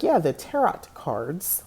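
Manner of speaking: TAROT said "TAIR-ot", slowly; mispronounced final T. Retold or imitated. Quoting old self to a girl, TAROT with a sounded T. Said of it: "I pronounced the T in tarot".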